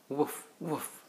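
soft, drowsy little woof woof; a tired puppy settling to sleep; sleepy puppy bark. Acted. Dad reading a bedtime book, barking softly as the sleepy puppy.

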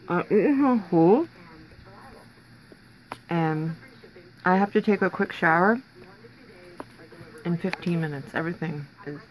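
words muffled by food; talking while chewing. Real, not acted. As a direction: mouth full of snack. Seen 0: Bites snack at -1, food visibly in mouth while speaking.

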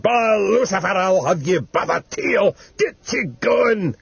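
high, slow, drawn-out threat; raving shout. Acted. Dying old man fighting the devil, high raving shout. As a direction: rail at the devil, pitched right up. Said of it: "he shouted".

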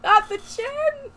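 high, slow wail of mock dismay; mock horror. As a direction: drag it out in fake despair.

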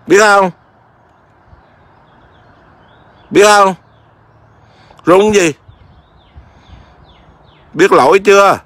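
loud, high stern questions to the pet; stern scolding. Real, not acted. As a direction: scold the naughty bird. Master scolding pet bird loudly.

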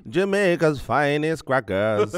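put-on slow, drawn-out Jamaican accent; mock Jamaican accent. Co-panelist parodies the cracker line on request, mock Jamaican accent. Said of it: "Now in a Jamaican accent with your eyes closed".

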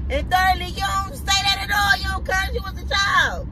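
high-pitched motherly scolding, retold; fussing. Mother retelling her lecture to cussing son, fussing. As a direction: lecture him point by point, pitch up. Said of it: "'cause I'm fussing at him".

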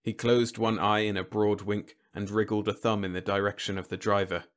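plain reading voice

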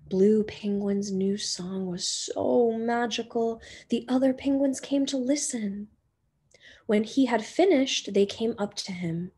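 usual speaking voice

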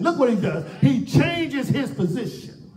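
emphatic shouted sermon cadence; impassioned preaching. Real, not acted. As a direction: challenge the congregation with rising force. Preaches at full force.

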